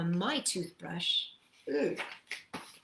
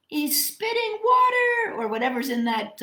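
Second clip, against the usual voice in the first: excited; 13 semitones higher, 5 dB louder than usual. Acted. Pointing out yottle to kids.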